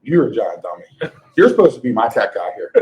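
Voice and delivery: ordinary talk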